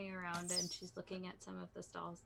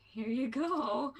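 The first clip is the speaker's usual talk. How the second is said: loud, higher, drawn-out sing-out; cheery handover; 6 semitones higher, 12 dB louder, slower than usual. Acted. Lasha counts out gold for the merchant, loud and drawn out. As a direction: hand it over with a big flourish.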